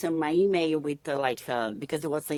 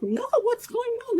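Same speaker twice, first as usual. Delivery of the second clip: much higher, baffled question; confused surprise. Retold or imitated. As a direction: ask as if totally lost. Confused by messages.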